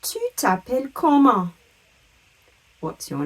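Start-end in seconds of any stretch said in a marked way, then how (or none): 0.0-1.5 "Tu t'appelles comment" in one connected high phrase; high, joined-up "Tu t'appelles comment"; French model question. Shown as a demonstration. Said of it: "the question that means".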